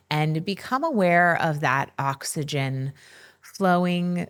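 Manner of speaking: slow, measured meditation voice with long pauses; unhurried, soothing breathwork narration; guided meditation. Acted. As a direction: guide listeners gently into stillness, leaving space between phrases.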